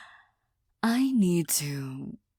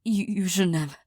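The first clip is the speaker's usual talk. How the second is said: ordinary talk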